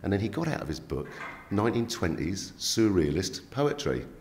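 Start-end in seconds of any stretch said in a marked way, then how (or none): none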